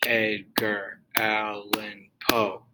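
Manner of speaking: very slow, each syllable spaced apart; Ed-gar Al-lan Poe, one beat per clap; clapped out syllable by syllable. Shown as a demonstration. Teacher claps out the name for students, very slow and separated. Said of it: "like, the clapping thing".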